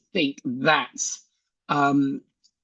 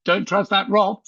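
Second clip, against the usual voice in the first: suspicious neighbour; 6 semitones higher, 3 dB louder than usual. Retold or imitated. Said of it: "They might know me and go".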